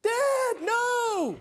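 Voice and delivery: a very high, loud cry of panic; frantic scream. Retold or imitated. Customer screaming at her armed father, frantic scream. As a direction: scream it as your father walks into danger. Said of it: "starts screaming".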